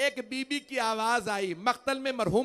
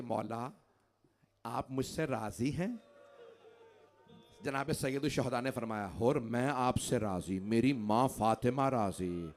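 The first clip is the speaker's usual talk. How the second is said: much softer, low tender telling; hushed grieving; 7 semitones lower, 6 dB quieter than usual. Acted. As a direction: drop almost to a murmur. Quiet tender climax of dying Hurr. (دھیمی، نرم، غمگین آواز)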